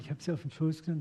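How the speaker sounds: ordinary delivery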